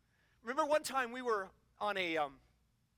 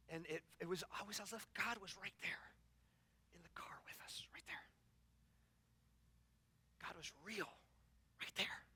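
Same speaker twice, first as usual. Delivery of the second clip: unvoiced, hushed and reverent; whispered awe; 5 semitones lower, 15 dB quieter than usual. Real, not acted. Preacher recalling God in the car, whispered awe. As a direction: breathe it like a secret. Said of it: "whispers about God's presence".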